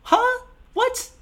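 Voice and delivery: a confused audience member hamming up disbelief; mock confusion. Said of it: "I still had questions like".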